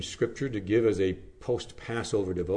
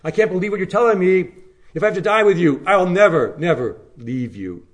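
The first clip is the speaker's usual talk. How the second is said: louder, higher, refusing to listen; stubborn denial; 5 semitones higher, 6 dB louder than usual. Acted. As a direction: shout over the warning like fingers in the ears. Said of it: "fingers in his ears saying".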